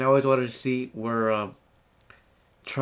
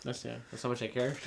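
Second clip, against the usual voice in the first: sarcastic; 11 dB quieter than usual. Real, not acted. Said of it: "could sense your sarcasm".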